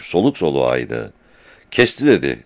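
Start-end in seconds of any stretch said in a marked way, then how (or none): 1.7-2.1 high-pitched, agitated outburst. A narrator's reading. Seyran runs up to report the cut water, agitated. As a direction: blurt out the bad news. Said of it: "heyecan içinde".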